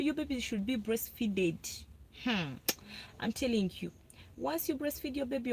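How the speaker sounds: ordinary talk, nothing marked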